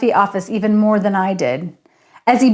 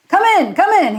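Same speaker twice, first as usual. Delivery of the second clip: bellowing; 10 semitones higher, 5 dB louder, faster than usual. A narrator's reading. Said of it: "he bellowed".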